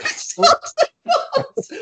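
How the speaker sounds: high, slow mock disbelief through laughter; laughing echo. Real, not acted. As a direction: laugh his words back at him. Mocking echo of friend.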